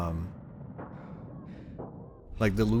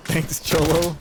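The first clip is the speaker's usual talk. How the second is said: put-on Mexican voice; 7 semitones higher, 6 dB louder, slower than usual. Put-on voice thanking a player.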